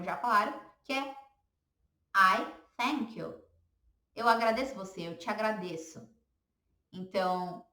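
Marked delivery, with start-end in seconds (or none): 2.2-3.5 "I thank you" said slowly, each word clear; carefully spelled out, half speed; slow model phrase. Shown as a demonstration. Teacher slowly models I thank you for students. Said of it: "uma frase que eu acho".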